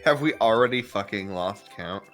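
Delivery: ordinary talk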